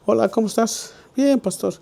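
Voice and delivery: a hint of a shy boy answering; voicing the boy.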